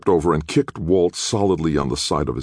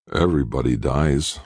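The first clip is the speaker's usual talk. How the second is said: usual speaking voice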